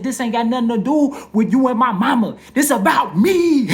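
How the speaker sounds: high, very loud comic outburst; retold outraged yell. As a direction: yell it in mock outrage, stretching the end. Acted outburst at his father.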